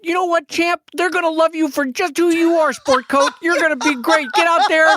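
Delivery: high, loud, gushing proud dad pep talk; adorable dad. Retold or imitated. Mocking Brad's dad voice to a nervous son. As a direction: cheer him on like a doting dad. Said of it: "you're giving off adorable dad energy".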